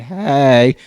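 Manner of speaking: low, loud, drawn-out greeting; weird voice. Retold or imitated. Mom calling the girlfriend unannounced, weird low voice. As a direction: imitate mom's odd deep phone voice. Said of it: "There's this weird voice saying".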